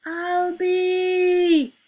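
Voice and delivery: long, high, loud furious scream. Acted. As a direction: scream his name in fury. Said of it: "screamed Mary".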